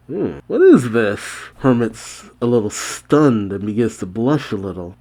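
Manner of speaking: ordinary delivery, nothing marked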